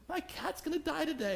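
high, far-off, lightly voiced teary coworker; a principal's plea about her cat, mock teary; imitating upset colleague. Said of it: "she's about to cry".

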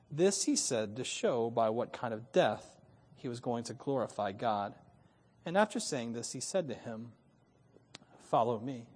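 measured, deliberate Bible reading; scripture reading. Acted. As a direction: read the passage with weight. Reads John 21 aloud.

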